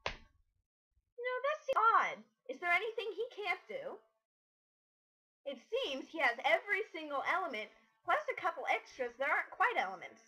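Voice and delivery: high, sarcastic faux wonder; mock surprise. Cousin sarcastically marvelling at the character's powers, mock amazed. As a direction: feign amazement to mock him.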